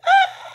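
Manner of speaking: sudden high shriek of fright; a storybook scream, high and sharp; fearful shriek. Acted. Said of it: "They all let out a fearful shriek".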